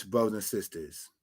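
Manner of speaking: ordinary delivery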